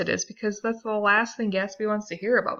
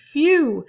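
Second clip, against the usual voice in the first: higher, louder sigh of relief; relieved phew; 9 semitones higher, 8 dB louder than usual. Retold or imitated. Nick relieved at Gatsby's answer, loud high phew. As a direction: blow out relief. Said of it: "Nick is like".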